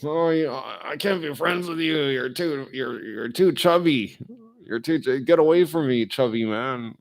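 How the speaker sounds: hammed-up snob rejecting a chubby friend; mock snooty friend. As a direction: sneer at the chubby man. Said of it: "I'm not gonna, like".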